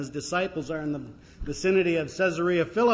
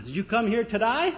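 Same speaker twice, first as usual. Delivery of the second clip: Australian cabbie, pitched up at the end; Australian accent; 5 semitones higher, 3 dB louder than usual. Acted. Cabbie greeting a visitor from the United States, Australian accent. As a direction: ask it in a broad Australian accent. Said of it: "he's not speaking Australian".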